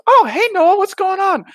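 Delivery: very high, mock-cheery mom voice; chatty mom. Buddy's mom greets him round the corner, chatty high voice. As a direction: squeal a greeting like a friend's mom.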